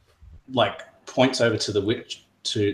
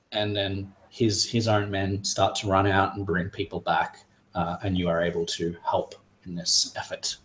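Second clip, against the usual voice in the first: ordinary delivery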